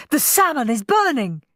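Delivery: high, alarmed cry; mild scream. A narrator's reading. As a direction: raise the alarm about dinner in panic. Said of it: "screamed Dad".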